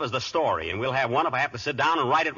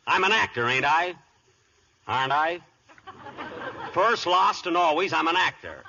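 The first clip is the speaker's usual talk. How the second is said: mock-tragic insistence; hammy self-declaration; 4 semitones higher than usual. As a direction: declaim it like a wounded ham actor. Grandly insists he is an actor.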